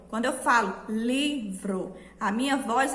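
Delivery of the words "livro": "livro" in two syllables, LI stronger than vro; stress on LI. Shown as a demonstration. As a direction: stretch the LI of "livro", then a separate vro. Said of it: "entonação foi mais para a sílaba li". ("livro" separado, com a sílaba LI mais forte)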